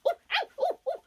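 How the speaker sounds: very high, loud yelping cries; pained yelp. Acted. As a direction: yelp like a fox whose paw got bitten. Dog biting fox.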